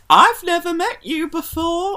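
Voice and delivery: high, laughing mock-innocent "never met you"; mock Davina fib. Retelling Davina's on-stage fib in a high, laughing mock voice. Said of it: "saw Davina on stage being like".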